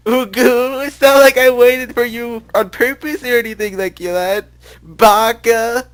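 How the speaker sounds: put-on high, loud, slow tsundere girl; cute schoolgirl voice. Shown as a demonstration. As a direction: squeal it like an anime schoolgirl. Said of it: "that's my cute, screw, cool schoolgirl voice".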